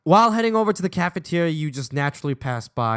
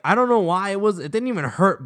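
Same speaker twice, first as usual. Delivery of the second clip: usual speaking voice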